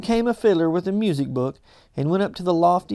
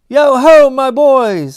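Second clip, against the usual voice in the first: slow, much louder and higher rich hearty call; jovial fat voice; 8 semitones higher, 12 dB louder, slower than usual. A narrator's reading. Fezziwig calling his apprentices, jovial booming call. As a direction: boom it out like a jolly old master. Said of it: "said Fezziwig".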